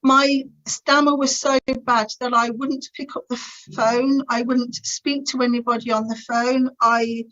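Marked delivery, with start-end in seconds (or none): none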